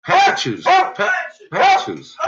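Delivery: high, insistent call; calling the dog. Real, not acted. Owner calls dog Patches, high calling voice.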